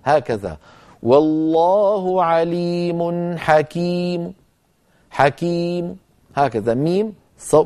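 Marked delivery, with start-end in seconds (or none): 1.0-6.0 slow chanted recitation, the final damma of "حكيم" left as a faint trace; stopping with rawm. Shown as a demonstration. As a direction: recite slowly and let "حكيم" end on a barely voiced u. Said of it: "وأقف بميم آخرها ضمة صوتها ضعيف. هكذا". (تلاوة بطيئة مع الروم في "حكيم" بضمة ضعيفة)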